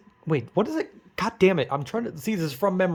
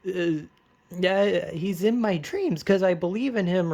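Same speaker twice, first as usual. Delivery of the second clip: put-on dreamy teen girl; 5 semitones higher than usual. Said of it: "Ashley's like".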